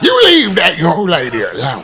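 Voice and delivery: laughing, high, loud Southern voice, slow; Southern accent. Retold or imitated. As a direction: ham up the Southern gentleman, laughing. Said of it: "What accent was that?".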